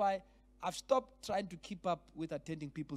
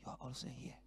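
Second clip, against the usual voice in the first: breathed out in disbelief, barely voiced; half-whispered, stunned recognition; softer retold whisper of surprise; unbelieving whisper; 8 semitones lower, 13 dB quieter than usual. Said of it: "with unbelieving eyes".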